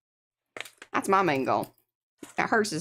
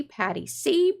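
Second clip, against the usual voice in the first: ordinary talk, nothing marked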